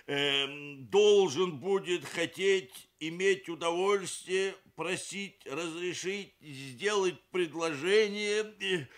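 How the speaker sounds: high, slow, laboured string of verbs; flustered father. A narrator's reading. Flustered papa fumbling a formal marriage phrase, at a loss. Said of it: "папаша совсем растерялся и произнёс что-то длинное".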